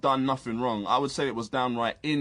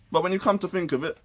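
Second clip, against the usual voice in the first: ordinary delivery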